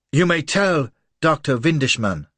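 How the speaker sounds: loud, scornful command; contemptuous. A narrator's reading. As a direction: spit it with contempt. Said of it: "husky voice charged with contempt".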